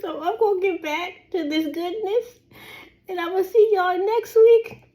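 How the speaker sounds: high, half-crying sign-off; tearful. Real, not acted. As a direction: push through happy tears. Crying with joy while signing off.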